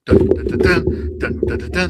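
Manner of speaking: loud, punchy mock drum fanfare; vocal fanfare. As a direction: voice a quiz show sting.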